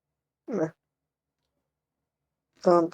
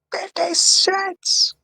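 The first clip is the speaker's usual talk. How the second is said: outraged; 11 semitones higher, much slower than usual. Acted. Indignant game character reading.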